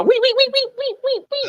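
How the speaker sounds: rapid high squeaks fading off; squeaky toy noises. Pretend. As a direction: squeak like a rubber toy being squeezed. Squeaking while putting on bra.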